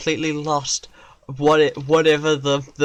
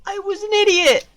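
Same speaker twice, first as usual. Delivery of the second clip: high, fast, loud cry of self-blame; anguished outburst; 6 dB louder than usual. Real, not acted. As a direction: shout it in exasperation, pitch leaping up. Outburst at own blunder.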